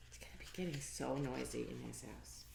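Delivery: soft whispered aside; hushed aside about the noisy house. Real, not acted.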